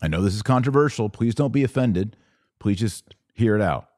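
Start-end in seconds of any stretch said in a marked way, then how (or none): none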